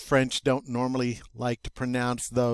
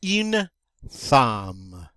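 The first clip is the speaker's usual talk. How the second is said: teacher breaks the word apart; split into parts; 3 semitones lower than usual. Shown as a demonstration. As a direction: say Une, pause, then drop to chose. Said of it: "teacher splits the word".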